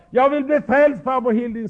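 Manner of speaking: a hint of a pleading child; little boy. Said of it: "en liten pojk som sa".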